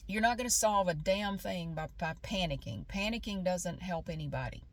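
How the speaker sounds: ordinary talk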